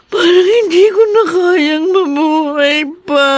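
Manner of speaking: umiiyak na nagdadalamhati, paputol-putol ang salita. Acted. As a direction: parang dinudurog ang puso sa pagluluksa. Grieving mother over dead child. (umiiyak na ina na nagluluksa sa anak)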